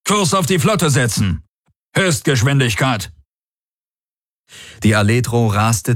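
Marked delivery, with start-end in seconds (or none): 0.1-3.1 high-pitched, urgent command; called-out order. A narrator's reading. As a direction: call out orders to the helm, pitch raised. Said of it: "rief der Feldherr".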